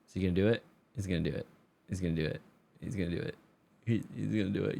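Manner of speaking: low, soft mock commentary; hushed suspense. Player watching his own misplay coming, hushed suspense. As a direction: murmur it low, like a worried onlooker.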